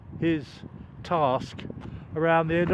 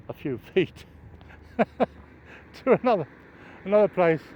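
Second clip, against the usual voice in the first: laughing through words; 3 semitones higher than usual. Real, not acted. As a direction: chuckle between the words. Chuckling while recalling pointless labour.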